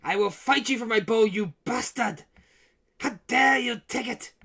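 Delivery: loud, raging cry over a stolen bowl; furious shout. Acted. Mad king shouting at the party over his bowl. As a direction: roar at the thief who took it.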